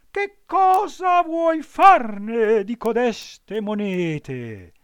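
very high, loud, slow eager fox voice; hungry for the gold; greedy fox. A narrator's reading. Fox eagerly asks about coins.

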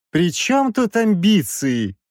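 high, drawn-out protest; indignant squeal. A narrator's reading. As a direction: squeal it in outrage. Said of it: "возмущённо взвизгнул Краевский".

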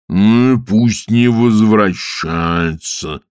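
very slow dismissive rumble; gruff water spirit. Acted. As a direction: brush it off slowly. (грубый голос Водяного)

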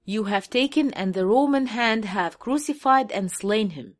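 very slow, word-by-word English Bible reading; slow scripture reading. Shown as a demonstration. Reading the English verse aloud to his audience, very slow and deliberate. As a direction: lay out each word of the verse slowly.

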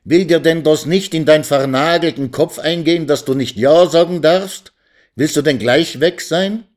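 high-pitched, exasperated scolding shout. A narrator's reading. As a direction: raise your voice and berate him. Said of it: "erhob er seine Stimme mächtiger und voller".

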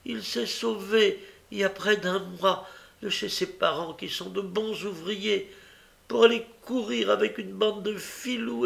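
high, lamenting false story; fake plaintive tale. Young woman tells bystanders a sob story, high and plaintive. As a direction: win the crowd's pity. Nancy lies to the women.

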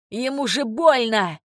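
slow, drawn-out protest; mild shout of distress; protesting cry. A narrator's reading. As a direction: cry out for him, stretching the words. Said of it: "закричал молодой мужчина".

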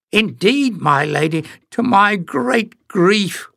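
high, slow, put-on whimper. A narrator's reading. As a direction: fake a pitiful whimper of grief. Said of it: "whimpered Jinx".

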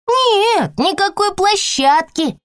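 put-on high stern little girl; cutting off a whining child; bossy child. A narrator's reading. Girl refuses firmly. (строгий детский отказ)

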